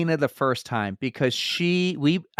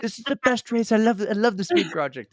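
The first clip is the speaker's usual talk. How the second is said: hammed-up British accent, pitched high; bad British accent; 6 semitones higher than usual. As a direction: imitate an excited Brit with a shaky attempt at the accent. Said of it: "saying in a British accent".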